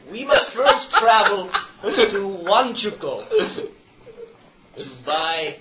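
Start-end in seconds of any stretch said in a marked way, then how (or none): 0.1-3.3 talking through laughter, a bit louder; laughing. Real, not acted. Player laughing at his own joke while giving directions, laughing. After making a small joke.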